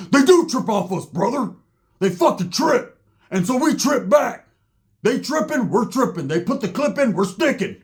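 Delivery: high-pitched, worked-up retort; imitated heated skinhead. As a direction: argue back in a high, insistent voice. Skinhead heatedly defends tripping back.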